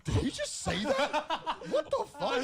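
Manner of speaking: high, slow, laughing disbelief; shocked disbelief. Retold or imitated. Friends in the cinema staring at each other, stunned laughing disbelief. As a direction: gasp it out like you can't believe your ears. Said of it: "we all looked at each other like".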